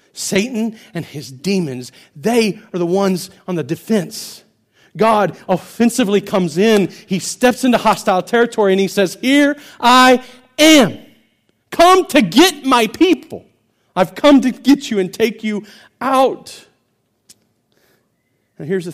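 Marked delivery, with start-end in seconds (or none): 0.1-4.4 building louder, slow and firm; rising emphasis. Acted. Preacher declaring to the congregation, building louder. Preacher swells back after whisper. 5.0-16.7 full-force preaching. Acted. Preacher proclaiming God's rescue, full shout.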